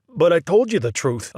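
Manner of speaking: high, loud bewildered protest. A narrator's reading. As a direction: plead your innocence. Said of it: "Oscar protested".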